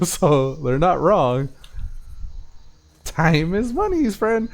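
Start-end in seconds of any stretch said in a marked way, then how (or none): 3.0-4.5 high, slow squeaky goblin. Retold or imitated. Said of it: "I heard it in the goblin voice".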